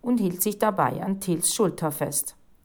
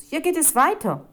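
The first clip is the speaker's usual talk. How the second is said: raised, eager call; Rufus calling out; 9 semitones higher, 5 dB louder than usual. A narrator's reading. Boy leading on to the back room, calling out. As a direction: call back from further ahead. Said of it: "rief Rufus".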